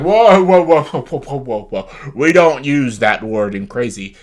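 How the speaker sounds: loud mock scolding whoa; hammed-up scolding cast member; loud mock whoa calling out; mocking Brennan. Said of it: "Brennan's like".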